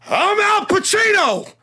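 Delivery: high, loud, slow shouted I'm Al Pacino. Retold or imitated. Retelling a bathroom mix-up, Al Pacino shouts his own name. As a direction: bellow his name back like an offended star. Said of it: "the guy turns around and goes".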